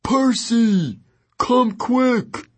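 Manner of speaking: very high, urgent character call; distressed calling. A narrator's reading. Tyson calls Percy over to injured Grover, distressed. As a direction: cry out for help in a high voice.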